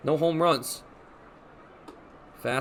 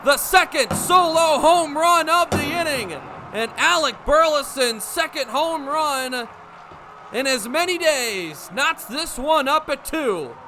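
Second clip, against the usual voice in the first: high, loud excited call; home run call; 9 semitones higher, 5 dB louder than usual. Acted. Announcer calling a home run for the fans. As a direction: lift the voice as the ball carries.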